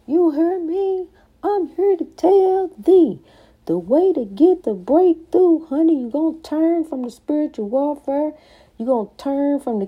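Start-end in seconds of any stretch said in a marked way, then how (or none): 0.1-8.3 preaching. Acted. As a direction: rise up and preach it. Preaching climax.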